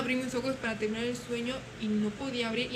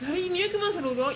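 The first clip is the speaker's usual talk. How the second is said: excited insistence; 7 semitones higher, 4 dB louder than usual. Retold or imitated. Insists to coworker about boy.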